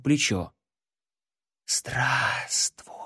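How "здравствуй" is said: eerie unvoiced whisper; whispering darkness. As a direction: breathe it like living shadow. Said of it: "прошептала тьма".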